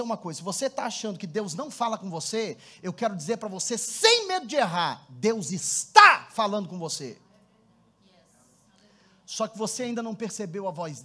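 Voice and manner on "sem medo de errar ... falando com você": slow, firm, every word weighted; slow down and lean on each word; emphatic assurance. Real, not acted. Pastor assuring the congregation God speaks, slow and firm.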